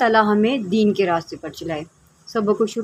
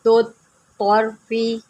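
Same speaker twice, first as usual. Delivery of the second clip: slow, drawn-out tajweed chanting; measured melodic Quranic recitation; much slower than usual. Shown as a demonstration. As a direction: recite slowly, stretching each vowel. Recitation of verse.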